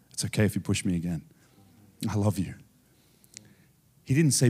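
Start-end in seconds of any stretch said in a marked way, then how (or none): 0.1-2.5 low, soft, forgiving reassurance; gently forgive the one pushing; acted kindness. Acts out kindness while being pushed.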